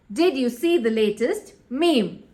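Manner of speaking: MEME as "meem"; repeated model "meem" for meme; correct pronunciation. Shown as a demonstration. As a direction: say meme in one syllable. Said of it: "It is".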